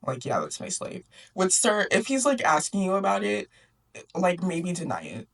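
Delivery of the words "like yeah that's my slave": dismissive uncle. Acted. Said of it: "And Uncle's like".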